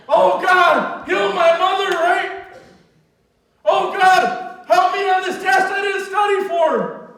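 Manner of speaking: hammed-up begging to God; mock desperate prayer. Preacher mimicking people's panicked prayers loudly.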